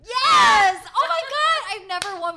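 high, loud shrieks of joy; ecstatic winner. Real, not acted. Hosts announce her the winner, overjoyed squeal. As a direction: burst out squealing at winning. Wins mock award, overjoyed.

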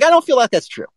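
high, dry disbelieving reply; sceptical retort. Retold or imitated. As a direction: call his bluff. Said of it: "I was like".

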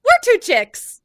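high, loud cheer; excited announcing. Retold or imitated. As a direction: announce it with glee. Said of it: "And I said".

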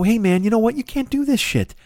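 high-pitched mock scolding; vigilante lecture. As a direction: lecture them like a self-appointed enforcer. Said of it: "Just to go".

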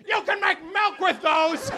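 a hint of a street crank yelling; mock shouting guy. Comic retelling of a crank yelling at a crowd. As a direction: bark it like the man on the corner. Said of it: "He's like".

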